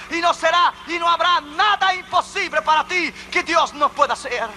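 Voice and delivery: high, ringing sermon climax; full-force preaching. Real, not acted. Preacher urges the congregation to praise God, full-force preaching.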